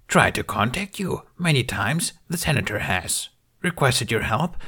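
high, croaky little Jedi master; Yoda voice. A narrator's reading. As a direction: speak as a wise old Yoda. Yoda speaking in the story.

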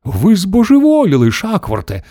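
high-pitched angry bark that drops on the name. A narrator's reading. Mission Control rebukes a crazy-sounding astronaut, barking. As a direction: bark it out indignantly. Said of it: "гаркнув центр керування".

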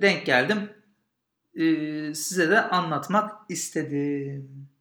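usual speaking voice

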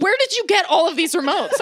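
high-pitched mock disbelief, half laughing; incredulous. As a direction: question mom in comic exasperation. Retells quizzing mom about remotes.

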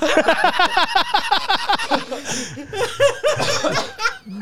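very high cartoon laugh; SpongeBob laugh. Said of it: "I can SpongeBob laugh".

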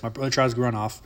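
normal talking voice

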